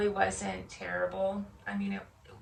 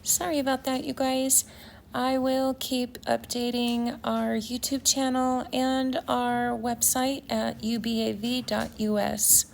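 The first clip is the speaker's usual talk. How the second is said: brisk, slightly louder outro read to viewers; channel sign-off; 7 dB louder than usual. Acted. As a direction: wrap it up warmly for the YouTube audience.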